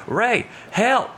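mock-panicked, high-pitched plea; comic high-voiced distress call; retold cry for help. Retold cry for help while dragged.

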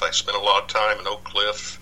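ordinary delivery

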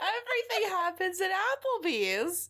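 can barely get it out for giggling; laughing while talking. Real, not acted. Laughing at the Applebee's joke.

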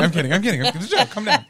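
loud, high laughing protest. Real, not acted. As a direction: yell it through laughter.

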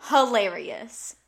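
hilarious drawn out slow and loud; stretched hilarious. Real, not acted. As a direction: lean hard on hilarious, dragging it out. Insisting it was funny.

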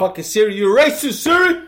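mock angry rant. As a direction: build into a fake furious rant. Yelling at the phone.

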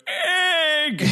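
high, drawn-out mock wail; hammed-up mournful old man; Maester Aemon's sad cry. Imitating Aemon calling his brother's name, high mock wail. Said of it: "he does say the word".

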